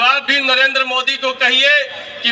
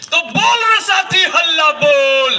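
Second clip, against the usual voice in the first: shouted slogan; 9 semitones higher than usual. Acted. Leader rouses the rally crowd, shouted slogan chant. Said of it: "इतना जोर से हल्ला बोलना होगा".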